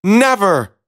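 high-pitched princess outburst; mild storybook shout. A narrator's reading. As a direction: cry out a high, defiant refusal. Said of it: "shouted the princess".

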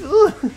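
loud high exasperated ugh; frustrated groan. Real, not acted. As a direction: let out a loud groan of annoyance. Frustration after teammate blocks.

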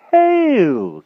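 high, loud bumbling knight; blustering old knight. Acted. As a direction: bluster like a dotty old king. Pellinore voice.

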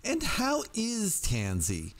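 high, slow and sweetly concerned; sweet solicitude. Acted. The dean of women asks Norman about his wife, with sweet solicitude. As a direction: ask with syrupy concern. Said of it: "with such a sweet solicitude".

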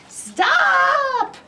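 very high, loud shouted stop; yelled. A narrator's reading. Big Anthony yells at the overflowing pot, high loud shout. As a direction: yell it at the runaway pot. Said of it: "yelled Big Anthony".

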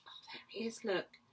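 soft breathy pointing aside; whisper. Real, not acted. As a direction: hush and point him out.

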